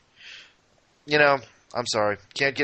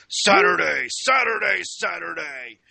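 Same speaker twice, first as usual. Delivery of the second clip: hyped arena announcer chant; rising then dropping hype call; monster truck ad; 4 semitones higher than usual. Shown as a demonstration. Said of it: "would you prefer me to go something like".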